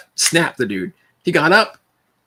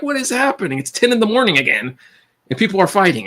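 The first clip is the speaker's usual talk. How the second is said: baffled disbelief; 7 semitones higher than usual. Retold or imitated. Baffled at the fight.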